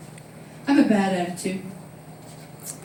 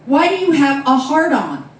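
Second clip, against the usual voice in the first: louder, higher insistent question; insistent repeat; 6 semitones higher, 8 dB louder than usual. Retold or imitated. Friend repeats it to the manager, louder and higher. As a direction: press the question again, innocently.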